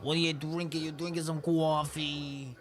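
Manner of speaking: a light mock of Reina's accent, coffee said as kwoffee; imitating Reina's accent. Teasing how Reina says coffee, lightly imitating Reina's accent. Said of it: "Reina has a fucking accent".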